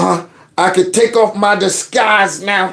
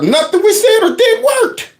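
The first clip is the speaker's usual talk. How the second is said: laughing it out, pitched way up. Pretend. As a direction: crack up through the line. Mocking Democrats' failed efforts.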